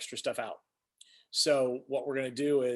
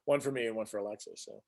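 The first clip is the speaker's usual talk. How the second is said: ordinary talk, nothing marked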